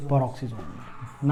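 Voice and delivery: drops his voice to let it sink in; soft repeat. Shown as a demonstration. Teacher quietly repeating the answer for the class. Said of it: "कहां enter कर गया?".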